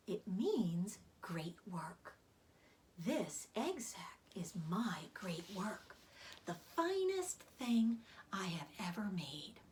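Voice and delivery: slow, weak whispery spider; fading spider, proud but tired; weak old spider. Acted.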